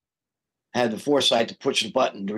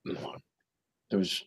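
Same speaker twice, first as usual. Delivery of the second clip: ordinary delivery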